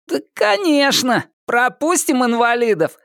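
насмешливый высокий голос задиры; громко объявить с издёвкой; громко, издевательски. A narrator's reading. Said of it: "громко объявил Костя Зайцев". (громко и издевательски)

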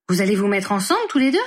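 exclaiming. A narrator's reading. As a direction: blurt it out in shock. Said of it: "s'écria-t-il". (s'écrier, surpris)

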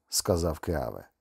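ordinary delivery, nothing marked

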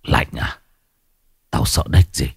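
mock her fear like something not quite human; icy possessed sneer; cold scoff. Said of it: "Ông quay lại cười nhạt".

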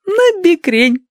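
very high, slow giggly voice; giggling. Acted. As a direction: giggle the words out. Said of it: "хихикнула молоденькая сестра Адати".

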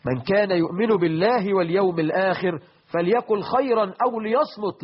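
ordinary delivery

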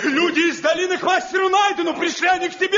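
high, scornful shout with a laugh; mocking angry shout. Heated quarrel between the masters. (насмешливый гневный крик)

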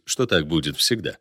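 ordinary delivery

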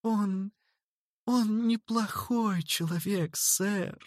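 put-on high, soft, slow little girl pleading; pitiful babble. A narrator's reading. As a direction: stammer it out pitifully. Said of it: "жалостно пролепетала Зерлина".

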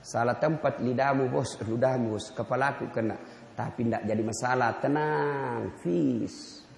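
low, gentle, unhurried voice of the Prophet; calm Prophet. Acted. The Prophet gently answers the man who spat, low and calm. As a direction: smile through the words, easy and forgiving. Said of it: "Nabi senyum dan berkata".

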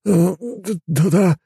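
high, halting hesitant yes; unsure stammer. A narrator's reading. Driver answers the inspector, unsure high stammer. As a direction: stumble uncertainly over the answer. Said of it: "неуверенно ответил мужик".

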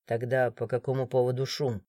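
low, quiet severity; deep, controlled authority; stern guard. A narrator's reading. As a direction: question them sternly without raising the voice. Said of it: "Прозвучало сурово".

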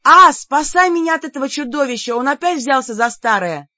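high, loud plea to a brother; calling for help. A narrator's reading. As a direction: cry out for rescue, indignant. Героиня зовёт брата на помощь.

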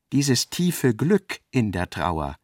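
ordinary talk, nothing marked

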